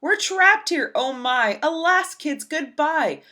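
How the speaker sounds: high, loud hammed-up panic; hammed-up teacher shrieking in terror; panicked scream. A narrator's reading. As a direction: wail it like the end of the world. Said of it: "started to scream".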